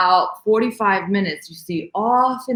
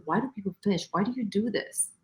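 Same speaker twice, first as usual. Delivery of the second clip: softer, inward questioning; inner thought; 8 dB quieter than usual. Retold or imitated. Remembering the moment on the boat, soft private question. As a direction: ask it quietly to yourself. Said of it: "I thought to myself".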